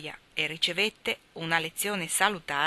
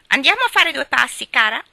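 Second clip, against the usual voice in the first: much higher, louder squawking parrot; 8 semitones higher, 13 dB louder than usual. Acted. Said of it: "esclamò Polly". (voce di pappagallo impertinente, acuta e forte)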